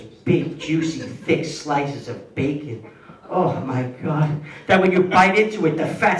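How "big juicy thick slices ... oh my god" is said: slow, low savoring. Acted. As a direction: relish each word slowly. Savoring food description.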